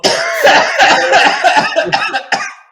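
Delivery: high, loud burst of laughter. Real, not acted. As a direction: crack up at your own story. Big laugh at stupid plan.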